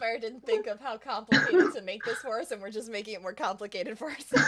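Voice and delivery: talking through laughter; giggling loudly mid-sentence; laughing. Real, not acted.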